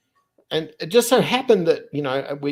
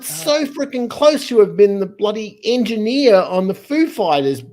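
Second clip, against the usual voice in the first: higher and louder, worked up; a real frustrated outburst, pitched up; exasperated disbelief; 8 semitones higher, 6 dB louder than usual. Real, not acted. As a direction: rant at how close it came. Exasperated at nearly missed chance.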